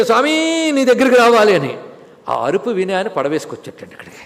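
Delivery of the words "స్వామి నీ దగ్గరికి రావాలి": louder and higher, a call across the river. Retold or imitated. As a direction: shout it to the boatman on the far bank. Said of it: "వాడిని ఇక్కడి నుంచి గట్టిగా అరిచేట్టు".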